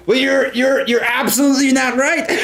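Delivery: high-pitched playful objection; mock indignation. Mock indignant rebuttal about soda.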